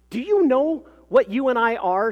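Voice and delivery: loud, high-pitched indignant demand. A narrator's reading. Fuming mother confronts her friend at school, indignant demand. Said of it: "she demanded".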